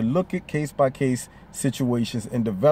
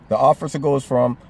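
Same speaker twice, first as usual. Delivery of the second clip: normal talking voice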